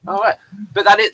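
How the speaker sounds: usual speaking voice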